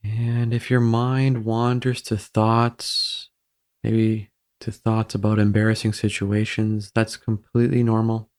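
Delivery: even, unhurried meditation voice with long pauses; guided meditation. Real, not acted. Guiding listeners through breathing to ease embarrassment, calm meditation voice. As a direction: guide them gently into stillness, evenly and calmly.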